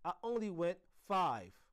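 loud, high protest; shouted. A narrator's reading. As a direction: shout your defence in a quarrel. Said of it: "Heidi shouted".

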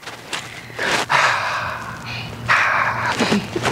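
long breathy sigh; excited sigh. Real, not acted. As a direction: let out a big sigh. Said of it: "a sigh of excitement".

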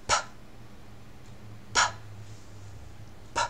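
P as a bare puff of air, no voice; isolated unvoiced P pops, spaced apart; voiceless consonant. Shown as a demonstration. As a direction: pop P with breath only. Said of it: "就是清辅音".

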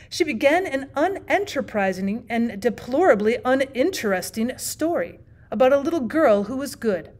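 usual speaking voice

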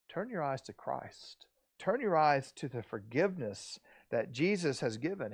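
hushed, near-whispered invitation; soft, gentle preacher's urging; tender appeal. Acted. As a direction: draw them in softly. Voicing God's gentle appeal.

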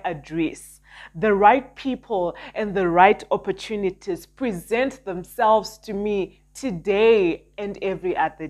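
fervent prayer declarations; self-help influencer. Acted. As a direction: declare each blessing with conviction. Declares prosperity affirmations.

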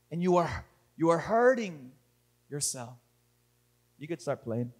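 low, hushed preaching with long pauses; solemn pastoral appeal. Real, not acted. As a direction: speak softly and gravely to a hurting congregation. Sermon turns to soft, reflective appeal.

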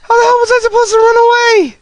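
fast, high, loud complaint; exasperated yell. Real, not acted. Exasperated after dying.

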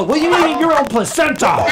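high, loud comeback; indignant retort. Retold or imitated. Singer to the shocked girls, loud indignant retort. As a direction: fire it back in mock outrage.

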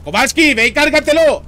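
loud, high battle command; shouted order. Real, not acted. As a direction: bark the order across the field. Ordering teammate to kill enemy.

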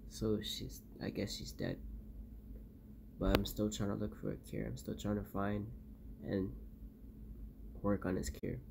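low, soft and weary; exhausted shaky survivor recording. Acted. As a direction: confess it quietly, worn out. Guilty quiet confession after loss.

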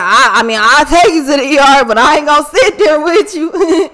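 mocking the boyfriend's lazy offer, half laughing; imitating boyfriend. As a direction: toss it off like it's a joke. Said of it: "He like".

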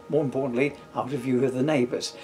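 usual speaking voice

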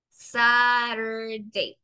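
Saturday said very slowly, every sound stretched; slow model word. Shown as a demonstration. Teacher models Saturday slowly to quiz the class. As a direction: spell out Saturday aloud by sound, unhurried. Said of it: "en este caso suena U".

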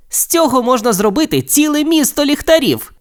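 high, wonder-filled exclamation; amazed excitement. A narrator's reading. Steve seeing the endless pumpkin field, amazed and excited.

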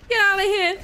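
high, loud frightened cry; squealed, scared command; panicked yell. Real, not acted. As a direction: yelp it as you flee. Fleeing a game enemy, scared.

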